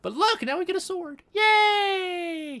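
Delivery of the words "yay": long, high-pitched "yay"; playful cheer. Pretend. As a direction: cheer in a silly high held note. Celebrates new sword.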